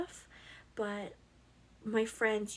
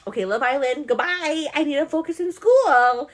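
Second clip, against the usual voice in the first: loud and higher, rising; 5 semitones higher, 13 dB louder than usual. Pretend. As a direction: shout it like a firm goodbye. Acted self-talk, loud.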